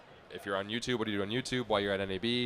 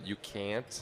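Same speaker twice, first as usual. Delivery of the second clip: Canadian accent; 7 dB quieter than usual. Shown as a demonstration. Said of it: "You can't say the T H sound".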